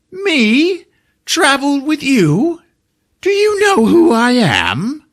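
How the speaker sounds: high, slow affronted wizard; haughty wizard. Retold or imitated. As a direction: scoff in outraged disbelief.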